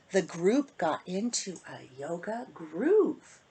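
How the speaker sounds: loud, lilting storybook read with big rises; sing-song bedtime story voice for the little ones; reading to children. Acted. Author reading her book to kids in storybook voice. Reads rhyming picture book aloud.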